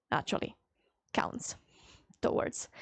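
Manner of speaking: breathy hushed two words; whispered aside. Real, not acted. Explaining the download tracker to chat, whispering. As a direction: drop to a whisper.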